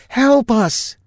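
high, loud desperate yell; desperate high shout. Acted. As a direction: yell while shaking his arm. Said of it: "she yelled".

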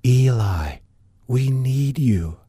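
slow, lightly voiced distant woman; a faint hint of an eerie radio plea; slow understated plea from afar; a touch of ghostly woman. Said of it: "The woman's voice came on the radio again".

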